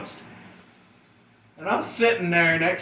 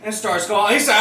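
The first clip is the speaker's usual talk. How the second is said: ordinary talk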